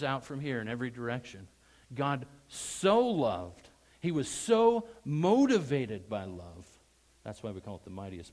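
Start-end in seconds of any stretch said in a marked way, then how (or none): 1.9-3.7 "so" slow, loud and elongated; stress on so. Shown as a demonstration. Preacher widening the verse to everyone, drawn-out stress on "so". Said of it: "emphasize so in your mind". 4.0-6.7 loud, lengthened "so", raised pitch; stress on so. Shown as a demonstration. Preacher explaining God's motive, emphatic drawn-out "so". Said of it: "emphasize so in your mind".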